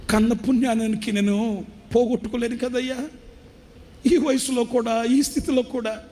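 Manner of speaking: high, pleading, close to tears; tearful plea. Retold or imitated. Preacher voicing the weeping old father, high and tearful. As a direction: cry out the sons' neglect as the old father. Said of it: "ఆ తండ్రి ఏడుస్తూ అంటాడు".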